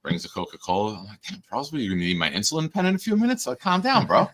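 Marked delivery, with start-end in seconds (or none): 1.3-4.3 mock scolding. Dad teasing son in retelling.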